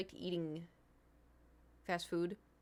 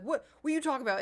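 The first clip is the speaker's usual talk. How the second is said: mock indignant; 7 semitones higher, 6 dB louder, much faster than usual. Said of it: "he'd be like".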